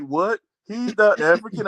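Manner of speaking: high, mock-puzzled question. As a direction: play someone suddenly confused. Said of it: "They're like".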